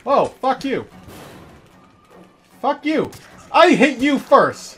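furious yelling at enemies; raging gamer. Real, not acted. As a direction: scream at the attackers.

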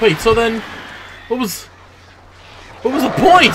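frustrated yelling. Real, not acted. Frustrated at wasted jumping.

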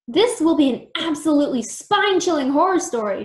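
loud gleeful cry. A narrator's reading. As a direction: cry out with glee. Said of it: "she cried".

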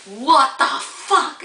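high, slow shocked outburst; stunned at oversleeping. Retold or imitated. Waking up late, shocked.

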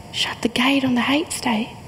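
whisper. Acted. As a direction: hush it like a secret. Poem line whispered.

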